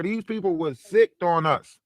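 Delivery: ordinary talk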